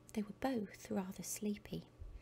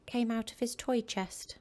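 ordinary delivery

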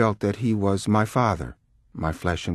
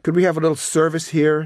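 high, loud demand for service; boisterous shout across a restaurant; 8 semitones higher, 6 dB louder, faster than usual. A narrator's reading. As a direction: call out impatiently for attention. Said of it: "he shouted".

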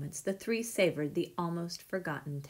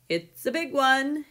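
excited shout, higher and louder; 6 semitones higher, 8 dB louder than usual. A narrator's reading. Postman stumbling down the street with news, shouting. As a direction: holler the big news. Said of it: "he shouted".

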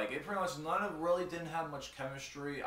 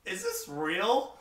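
slow, high incredulous question; mock disbelief; 9 semitones higher, 6 dB louder, slower than usual. Fan seeing Stardust first time, mock disbelief. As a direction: stretch it out in disbelief, rising sharply. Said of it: "looked at him like".

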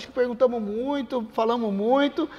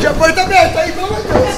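shouting; 7 semitones higher, 12 dB louder than usual. Real, not acted. Yelling during sparring.

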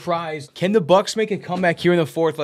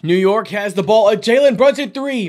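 high, loud shot call; excited play call; 4 semitones higher, 6 dB louder than usual. Real, not acted. Commentator calling Brunson's three, excited play call.

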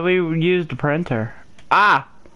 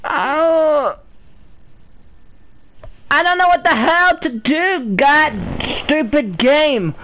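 higher, exasperated cry at the game; exasperated outburst; 7 semitones higher than usual. Real, not acted. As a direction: vent at the stupid game. Player raging at puzzle game.

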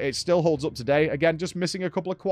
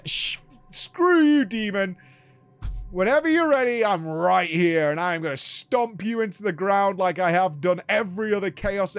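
defiant hero; 3 semitones higher than usual. Retold or imitated. Voicing hero defying demon.

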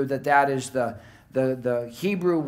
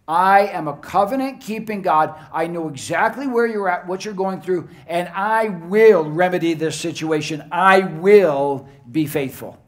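loud divine declaration; 3 semitones higher, 5 dB louder than usual. Acted. The Lord declares to his despairing people, loud and emphatic. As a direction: proclaim it like God vowing to his people. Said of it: "declares long and loud to his people".

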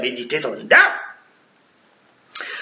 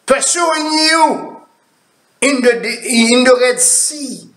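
preaching scripture; 8 semitones higher than usual. Acted. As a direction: read the verse like a preacher lifting the crowd. Preacher reading scripture with force.

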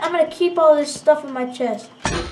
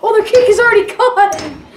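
loud, raised, on the edge of laughter; delighted loud outburst; gleeful exclamation; 4 semitones higher, 7 dB louder than usual. Real, not acted. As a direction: crow over the other team's loss. Laughing at rival team's loss.

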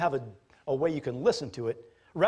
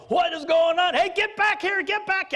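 much higher shouted panic; panicked herdsman shout; 5 dB louder than usual. Pretend. Herdsman yelling at stampeding pigs, high panicked shout. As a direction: yell after the runaway pigs. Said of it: "the herdsmen are going".

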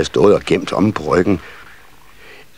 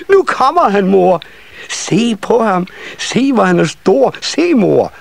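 put-on very high child voice, calling out; excited children calling; 13 semitones higher than usual. A narrator's reading. Children showing mother the visitor, high excited calling. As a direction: call to mother in a high, eager little voice.

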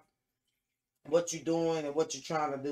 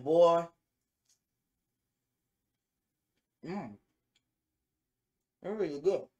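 talking while chewing food; words muffled by food; mouth full, chewing; 3 semitones higher, 4 dB louder than usual. Real, not acted. Seen 0: Bites fried food at 0.2–1.4, then chews with lips closed.

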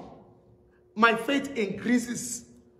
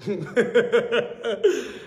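delighted laugh; 4 semitones lower than usual. Real, not acted. Joy at the answered prayer.

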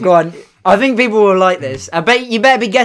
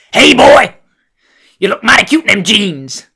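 Mister T impression; 5 dB louder than usual. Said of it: "Mister T".

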